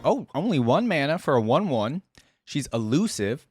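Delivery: usual speaking voice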